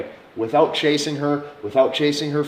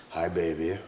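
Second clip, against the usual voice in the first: deep masculine voice; 7 semitones lower, 8 dB quieter than usual. Shown as a demonstration. Showing men how to greet her, deep and low. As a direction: drop it deep and controlled. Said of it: "your voice is maybe deep".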